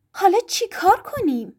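high, tense aunt voice, mildly angry; a touch of anger at her husband. A narrator's reading. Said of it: "با چنان خشمی به هری نگاه کرد".

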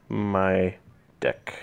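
deadpan near-whisper for the reveal; hushed punchline. Shown as a demonstration. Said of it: "There we go".

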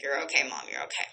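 low, soft murmur of comfort; soothing. Retold or imitated. Cat comforting its panicking owner, soothing. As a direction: voice the cat gently, hushed and low.

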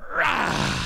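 short high growl-shout; hammed-up tough-guy roar at the camera; mock roar. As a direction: roar like a beast. Man roars at camera.

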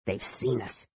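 very soft, low hiss. A narrator's reading. Dwarf to his friend as the noise stops, hissed low. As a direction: hiss it under the breath. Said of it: "Flint hissed".

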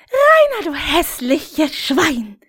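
shrill high jeer on Rainer, then a slow drag; mocking woman. Woman taunting Rainer in bed, high slow jeer. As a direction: sneer his name way up, then slow down. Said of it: "sagte eine der anderen Frauen".

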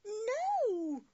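high, loud horrified no; shrill-free loud gasp of a no; horror. A narrator's reading. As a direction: cry out in horror. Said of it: "said Anne in horror".

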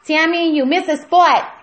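loud, high-pitched telling-off; scolding shout. Acted. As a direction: call out the assistant's mistake loudly. Boss scolds her nail assistant.

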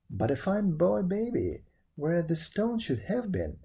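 usual speaking voice

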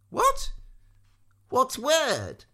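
high, startled squire; caught-out squire stammering. A narrator's reading. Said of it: "asked Maudmont again".

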